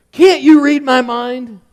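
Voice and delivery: high, loud, slow frustrated shout; frustrated yell. Retold or imitated. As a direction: yell it at your son out of sheer frustration. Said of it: "He yelled at me".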